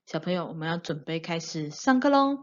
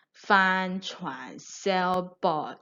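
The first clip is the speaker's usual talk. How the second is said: slow; much slower than usual. Shown as a demonstration. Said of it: "slow naming of new word".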